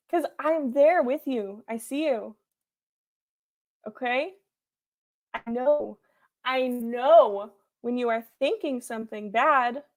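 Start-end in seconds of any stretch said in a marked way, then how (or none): none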